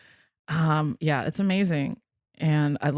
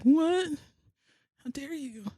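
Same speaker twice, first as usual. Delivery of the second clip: higher, indignant gasp of offence; mock outrage; 9 semitones higher, 6 dB quieter, slower than usual. Imagining being insulted by the producers, in mock outrage. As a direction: pretend to be scandalised. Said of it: "I would be like".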